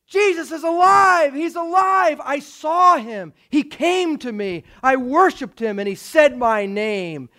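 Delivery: high, loud, jubilant cry; acted elation, slowing for emphasis; excited announcement. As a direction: burst in proclaiming wonderful news. Mary bursts in with news.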